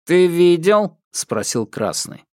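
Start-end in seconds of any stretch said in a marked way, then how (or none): 0.0-1.0 metallic patrolman. Acted. Patrolman questions Thomas.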